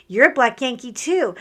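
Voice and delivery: loud happy reply; cheerful call back. Retold or imitated. Answering the fan, loud and cheerful.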